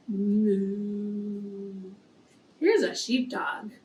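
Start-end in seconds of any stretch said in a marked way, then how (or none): 0.1-1.9 low, soft, drawn-out moo; cow moo. Shown as a demonstration. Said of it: "Please say the songs and the s-, sounds along with the animals".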